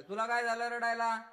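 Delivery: high, loud mother's voice asking why he cries. Retold or imitated. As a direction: call out like a worried mother.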